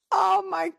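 bursting with genuine amazement; real excitement. Real, not acted. Reacts to the quote she read.

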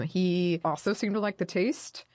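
ordinary delivery

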